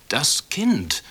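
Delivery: plaintive. A narrator's reading. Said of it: "sagte er kläglich".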